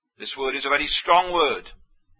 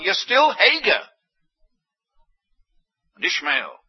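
normal talking voice

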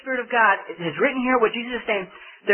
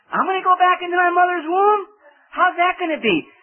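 a higher, incredulous questioning voice, lightly played; incredulous Nicodemus; 7 semitones higher than usual. Preacher voicing Nicodemus doubting rebirth, higher incredulous voice. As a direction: lightly voice old Nicodemus baffled at being reborn.

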